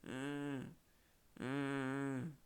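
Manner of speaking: soft, closed-mouth "mm" moan; creepy stifled moan from sleep; muffled sleep-paralysis moan. Retold or imitated. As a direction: try to yell but only hum. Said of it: "that comes out as me just going".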